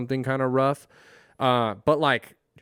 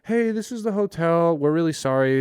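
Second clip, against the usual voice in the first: lightly voiced apologetic receptionist, pitched up at first; imitated hotel caller; 4 semitones higher, 4 dB louder than usual. Hotel calling guest to apologise, lightly imitated caller voice. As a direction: put on a little customer-service phone voice.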